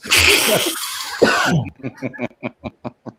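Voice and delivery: long hearty laugh. Real, not acted. Laughs at own joke.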